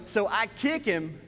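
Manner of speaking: ordinary talk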